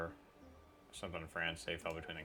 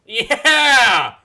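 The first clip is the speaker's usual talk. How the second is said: much higher, very loud whoop; triumphant cheer; 27 dB louder than usual. Real, not acted. Gamer cheers after taking down the target, whooping. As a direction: cheer the hit at full voice.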